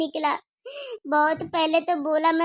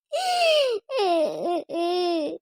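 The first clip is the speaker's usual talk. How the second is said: sobbing; 4 semitones higher than usual. Acted. Daughter breaks down at the end.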